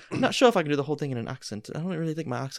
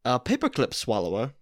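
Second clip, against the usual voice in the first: hammed-up storyteller voice; playful theatrical narration; dramatic story reading; 5 dB louder than usual. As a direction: read it like a grand dinner theater tale. Reads the Mad Lib story aloud.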